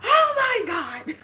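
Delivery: high loud crying oh my God; a pained laughing cry. Retold or imitated. As the therapist works, high crying wail. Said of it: "And I'm like".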